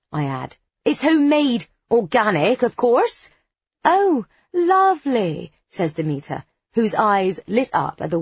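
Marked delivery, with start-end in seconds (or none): 0.8-3.3 put-on high-pitched West Country farm girl; hammed-up West Country lilt; broad West Country accent. A narrator's reading. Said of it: "in my broadest West Country accent". 3.8-5.5 high, drawn-out delight; slow, gushing approval. A narrator's reading. Demeter lights up at organic lemonade.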